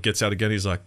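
ordinary talk, nothing marked

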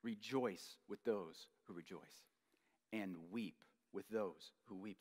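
gentle, hushed scripture, slow; slow and soft, like a comfort to the grieving; slow, tender reading. Shown as a demonstration. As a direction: read the verse slowly and softly, savoring it. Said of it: "one of the greatest verses on love".

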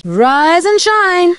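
high, drawn-out morning call; cheery wake-up call. Acted. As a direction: sing it out to wake someone.

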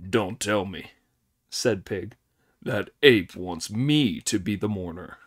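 a lightly voiced sulky Pig. A narrator's reading.

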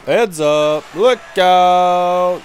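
normal talking voice

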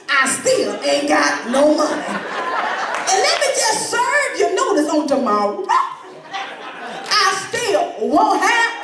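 very slow, word by word; emphatic refusal. Pretend. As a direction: spell it out slowly to a pesky caller. Defiantly telling off a bill collector.

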